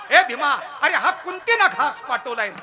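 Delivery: high, loud announcement; calling out. Acted. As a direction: hail him and proclaim it. Instructed call to giant eater.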